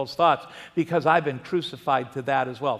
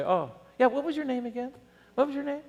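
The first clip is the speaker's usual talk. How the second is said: high, soft, mock-innocent asking; father teasing daughter's date, mock-innocent; feigned forgetfulness; 9 semitones higher, 4 dB quieter, faster than usual. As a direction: pretend you forgot his name on purpose.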